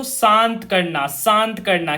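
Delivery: slow dictation. Shown as a demonstration. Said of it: "LA का मतलब क्या है बच्चों".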